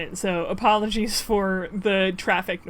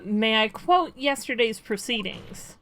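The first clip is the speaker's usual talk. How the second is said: ordinary talk, nothing marked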